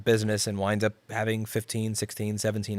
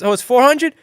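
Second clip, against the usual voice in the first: higher, louder shocked question on hundred; surprised customer; 13 semitones higher, 9 dB louder than usual. Retold or imitated. Customer gasping at the four hundred dollar quote. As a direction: act out the customer's disbelief at the price. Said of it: "And then she said".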